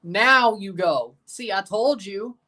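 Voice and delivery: usual speaking voice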